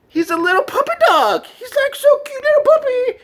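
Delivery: very high cutesy voice; squeaky puppy voice. Retold or imitated. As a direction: talk like a tiny excited puppy. Describing himself as puppy.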